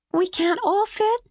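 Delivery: put-on high child voice, let down; a kid whose hopes just dropped; disappointed little girl. A narrator's reading. Mimi asks Will, high and disappointed.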